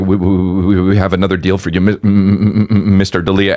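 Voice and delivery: imitating a starstruck stutter; nervous stammer. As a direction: stumble over the first sounds like a nervous fan. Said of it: "because he's nervous".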